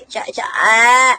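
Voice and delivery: slow drawn-out cry-shout; half-crying yelp, slowed down. Real, not acted. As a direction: let out a slow wailing call. Cries out mid-stream.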